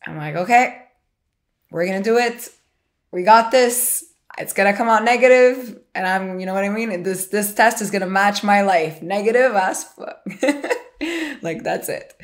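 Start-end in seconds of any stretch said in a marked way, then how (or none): 0.4-10.2 pumped-up, willing the test negative; forced positivity. Retold or imitated. To her best friend on the phone before the result, loud and pumped up. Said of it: "I'm actually trying to be positive". 10.3-11.4 short high burst of laughter; real laugh. Real, not acted. Laughing at her own joke to her friend, a high burst of laughter.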